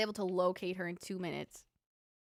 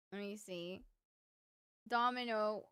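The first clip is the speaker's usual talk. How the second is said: ordinary delivery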